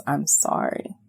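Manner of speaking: hushed, tender apology; soft apology. Pretend. Woman apologising to her inner child, soft and hushed. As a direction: say it softly, almost under the breath.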